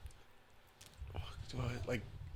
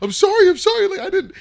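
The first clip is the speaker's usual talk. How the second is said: much higher, louder, half-laughing sobbed apology; mock crying plea; 13 dB louder than usual. Boy caught by dad, high mock-tearful begging. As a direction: beg forgiveness in a hammed-up cry. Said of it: "I'm, like, hella crying".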